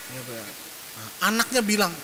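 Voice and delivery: usual speaking voice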